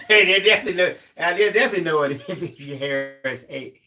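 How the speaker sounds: talking through real laughter; high, loud burst, words breaking into laughs; laughing. Real, not acted. Cracking up at own hair joke.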